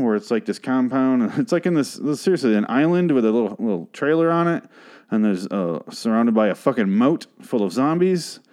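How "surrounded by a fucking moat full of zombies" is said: normal talking voice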